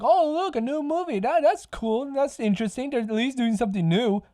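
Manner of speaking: high mock-naive voice; naive moviegoer. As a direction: play the clueless fan. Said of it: "if you guys just find out".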